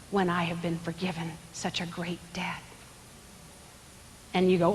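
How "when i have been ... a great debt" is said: soft whispered confession; hushed whisper. Real, not acted. Preacher confessing to the congregation in a whisper.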